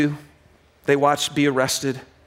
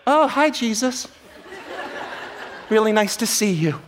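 laughing, high mock politeness; mock lukewarm greeting; 6 semitones higher than usual. Pastor parodying glad disciples, laughing mock politeness. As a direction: play it limp and polite for the joke. Mocking a tepid reaction.

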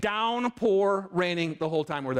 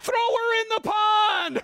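high, slow, gleeful shout; 13 semitones higher, 4 dB louder, slower than usual. Retold or imitated. Kids shouting back at the volunteer, high gleeful shout. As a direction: shout it like a mischievous kid. Kids' retort, retold.

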